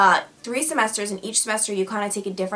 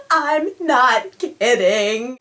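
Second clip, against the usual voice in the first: loud, slow, drawn-out mock sob; fake crying; 3 semitones higher, 5 dB louder, much slower than usual. Prankster keeps the lie going, fake crying. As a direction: stretch each word in a pretend wail. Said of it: "I'm making that kinda sound like crying".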